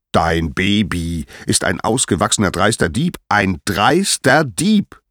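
rising outrage; angry accusation. Acted. Apollo angrily accuses the baby.

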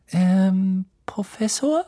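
high, tentative call; hesitant, questioning lift at the end; cautious. A narrator's reading. Hermine carefully approaches the sleeping professor, tentative. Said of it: "näherte sich vorsichtig".

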